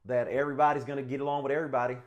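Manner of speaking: friendly Texas good-ol'-boy voice, hammed up; a loud, hammed-up Texas accent; mock Texas accent. Shown as a demonstration. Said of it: "Yeah, that's Texas".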